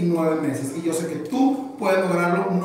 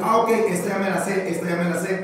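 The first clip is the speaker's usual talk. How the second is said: quick, repetitive run of inner speech; sin bajar la velocidad; faster than usual. Acted. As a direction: voice the student's brain rattling along fast. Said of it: "sin bajarle la velocidad". (rápido y seguido, sin pausas)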